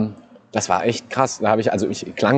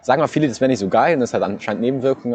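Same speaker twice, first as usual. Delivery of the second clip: normal talking voice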